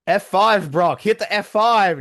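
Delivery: loud, high-pitched yelling at the fighter; mock fan shouting. Fan urging Brock to hit his move, mock yelling. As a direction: holler advice at the screen in play-acted urgency.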